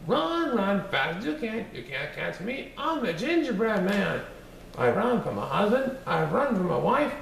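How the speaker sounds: high, sing-song taunting cookie voice; bouncy little gingerbread man bragging; cheeky gingerbread man. Acted. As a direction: tease them from far out of reach.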